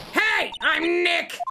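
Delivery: high, forceful shouted greeting. Acted. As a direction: shout it to set off a microphone. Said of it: "Maybe I'm not saying it loud enough".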